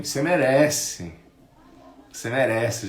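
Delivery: mock congratulations laced with envy; veiled envy. Relatives congratulating someone on a new car, veiled envy. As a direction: imitate praise that secretly begrudges. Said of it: "já destilando, né? A inveja ali".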